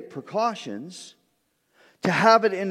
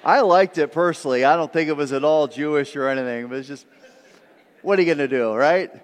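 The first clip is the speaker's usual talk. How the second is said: ordinary talk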